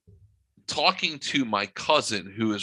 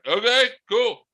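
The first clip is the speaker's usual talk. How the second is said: high, loud mock reply; hammed-up high okay cool; 12 semitones higher, 6 dB louder than usual. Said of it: "They're like".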